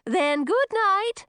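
drawn-out witchy farewell; witch voice. Acted. Witch bids good night.

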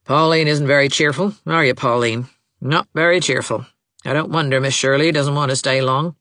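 lightly voiced peevish old woman; a hint of a reproachful matriarch; querulous mother, needling. A narrator's reading. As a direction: needle your daughter in front of company.

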